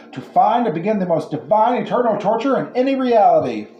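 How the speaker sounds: a gloating hive-mind demon on air; radio voice. Acted. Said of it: "The voice on the radio said".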